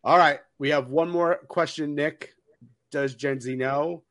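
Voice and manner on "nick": ordinary talk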